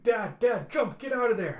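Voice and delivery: high, loud desperate shouting; frantic yell. Acted. Telsa screaming at her dad to jump. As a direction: scream at him to get out.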